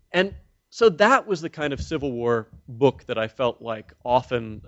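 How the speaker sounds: ordinary talk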